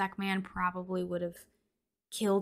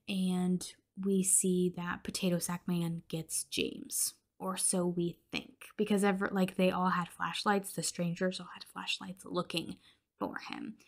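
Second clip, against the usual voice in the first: usual speaking voice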